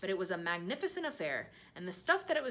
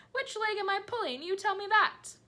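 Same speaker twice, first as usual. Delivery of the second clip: much higher, louder teasing voice; gleeful hysterics; 9 dB louder than usual. A narrator's reading. Centipede teases the earthworm in high loud glee. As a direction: tease him in shrill glee. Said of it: "sent the centipede into hysterics".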